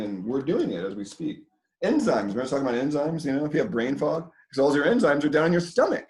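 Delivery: normal talking voice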